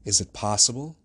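amazed disbelief; astonished exclamation. A narrator's reading. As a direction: ask it as a startled officer, voice lifting at the end. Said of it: "exclaimed the officer".

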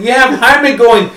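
ordinary talk, nothing marked

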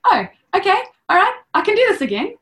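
high, loud and fast, pumping herself up; hammed-up brave cheer; mock psyched-up. Mother retelling psyching herself up in labour, mock cheerful. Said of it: "And I was".

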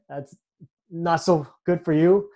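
ordinary delivery

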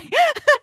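high squealing laugh; burst of laughter. Real, not acted. Owner cracking up at her own vet story, high squealing laugh.

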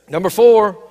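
number four, called out loud and falling; loud announcement. Real, not acted. Pastor to congregation announcing his final point, loud call. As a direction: belt out the point number to the room.

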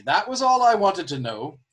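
doctor speaking up so the crew overhears, loud; said loudly. A narrator's reading. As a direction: announce it for the whole deck to hear. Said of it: "he said quite loudly".

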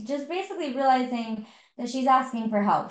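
normal talking voice

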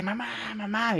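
high-pitched, held-back cry for mom; retold scream for mom. Son retelling how mother screamed for her own mom, mock high cry. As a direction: imitate a frightened child's scream without volume. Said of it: "tú gritaste".

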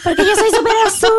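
high, put-on enthusiast voice; mock gushing. Parodying people who love maths, high gushing voice. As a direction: squeal it with fake pride.

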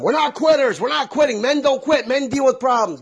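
shouting at Mark not to quit; yelling at son. Retold or imitated. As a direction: bark it at a quitting kid. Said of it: "I kept yelling at him".

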